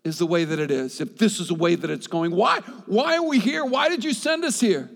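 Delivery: high, loud plea; crying out to God. Retold or imitated. Preacher voicing a believer's complaint to God, high loud plea. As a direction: call up to God in frustration. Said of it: "I prayed prayers like".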